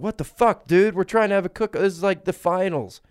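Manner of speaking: high, loud indignant yelling; outraged protest. Acted. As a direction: complain at full volume as an upset cook-off crowd. Acted outraged crowd in story.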